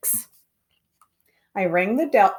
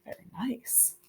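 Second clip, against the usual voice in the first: sarcastic whisper; 17 dB quieter than usual. Real, not acted. Sarcastic aside after insult.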